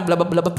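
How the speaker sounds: low mock drowning gurgle; gurgling noise. Preacher imitates Peter going under, gurgling. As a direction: blubber like water in the mouth. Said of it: "dia tenggelam gitu kan".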